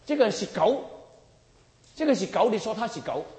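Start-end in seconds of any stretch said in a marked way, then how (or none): none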